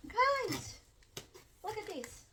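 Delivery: excited. Real, not acted. Showing the book to viewers.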